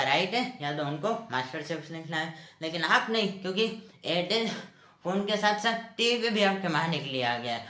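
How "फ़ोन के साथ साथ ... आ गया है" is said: ordinary talk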